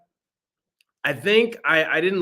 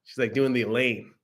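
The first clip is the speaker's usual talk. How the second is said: ordinary talk, nothing marked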